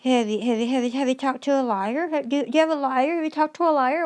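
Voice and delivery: mocking sing-song. Ranting vlogger mocks the court's question in sing-song. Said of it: "the fucking sing-song is always the same".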